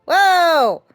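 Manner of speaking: ordinary talk, nothing marked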